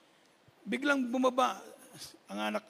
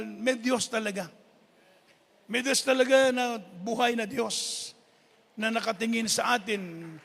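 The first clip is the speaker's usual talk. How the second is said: raised, louder preacher's voice; preaching at full force; 6 dB louder than usual. Acted. Preacher telling the congregation their life is with God, in full force. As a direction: proclaim it to the congregation.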